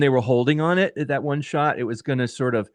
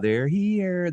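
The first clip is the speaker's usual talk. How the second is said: a lightly voiced, higher little-girl "they're here"; imitating the creepy ghost-child line; Carol Anne from Poltergeist; 8 semitones higher than usual. Host recalling the trailer voice, mild little-girl imitation. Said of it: "that sounded like, you know, Carol Ann".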